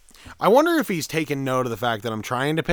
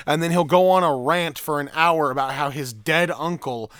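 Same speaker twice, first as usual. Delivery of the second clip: ordinary delivery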